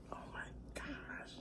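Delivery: breathy hushed excitement; excited whisper. Real, not acted. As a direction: whisper it, thrilled. Excited whisper before eating.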